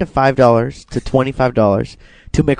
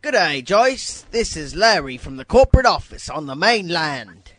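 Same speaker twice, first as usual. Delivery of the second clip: mock British accent; 4 semitones higher than usual. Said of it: "when I do the British accent".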